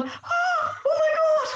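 high, shocked oh my God; shocked gasp. Retold or imitated. Reader horrified by a book scene, high shocked gasp. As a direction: recoil at a gruesome page. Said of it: "just going".

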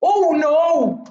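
high, loud oh no; dismayed cry. Real, not acted. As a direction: blurt out at the mistake. Mistake in game.

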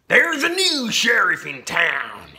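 high, loud, slow gunslinger drawl; menacing outlaw. A narrator's reading. Outlaw announces his takeover to the sheriff, slow and loud. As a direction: lord it over the town.

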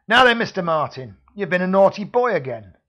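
loud sneering security man; menacing thug. Acted. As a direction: taunt him like a back-alley heavy. Villain accosts hero in smog.

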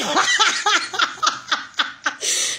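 long cackling laugh, falling away; helpless laughter. Real, not acted. Streamer overwhelmed by gifts, helpless cackling. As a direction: laugh hard in a long pulsing run.

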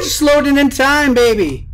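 loud, high crowing, easing at the end; triumphant shout. Real, not acted. Player who reloaded just in time, loud elated shout. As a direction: crow about the close call.

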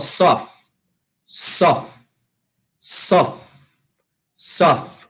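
"of" said as "sof", the S joined on; linked sound. Shown as a demonstration. Teacher drilling the joined sound, "sof" with a clear S. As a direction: isolate the joined syllable "sof". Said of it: "lo unimos al, a la of y decimos".

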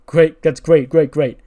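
loud, fast-building repetition of great; loud hyped interviewer. Retold or imitated. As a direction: pump it up like a hype-man host.

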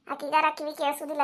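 ordinary talk

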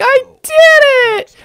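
put-on high, slow shouted kid cheer; squeaky Tails cheer. Gamer voicing Tails celebrating, high and shouted. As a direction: crow over the win in a tiny voice. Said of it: "And Tails is like".

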